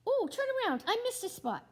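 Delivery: high, loud chirpy helper; cheerful cartoon voice. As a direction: chirp it happily.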